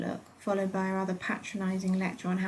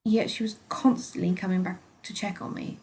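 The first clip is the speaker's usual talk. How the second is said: ordinary delivery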